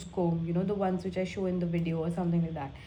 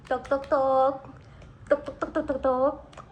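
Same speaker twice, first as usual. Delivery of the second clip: a hint of the trend voice, patting toner in; imitating the Tok Tok girl; 5 semitones higher than usual. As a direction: lightly mimic the viral toner chant. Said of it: "According to the Tok Tok girl".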